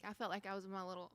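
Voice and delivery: ordinary delivery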